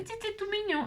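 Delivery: tiny high, soft voice; cutesy cooing. Real, not acted. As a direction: coo softly over something adorable. Cooing over a cute item.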